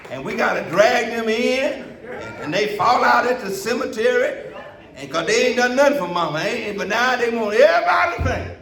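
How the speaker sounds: fired-up pulpit shout; preaching at full force. Acted. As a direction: preach it out to the whole church.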